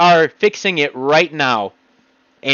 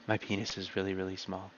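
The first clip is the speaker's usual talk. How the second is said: much softer, lower, laughing murmur; quiet lie; 10 semitones lower, 21 dB quieter than usual. Shown as a demonstration. Playing the liar's quiet admission, hushed and giggling. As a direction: mumble it away like a liar. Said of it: "See, I just lied there".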